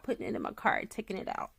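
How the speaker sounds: whispered trailing repeat. Real, not acted. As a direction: let taking it out fade to a whisper. Repeating cart routine, trailing.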